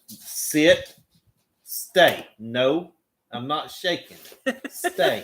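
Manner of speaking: getting ugly. Real, not acted. Owner making Poppy sit and stay, getting ugly. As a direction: order the dog firmly, word by word. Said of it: "hear me get ugly with Poppy".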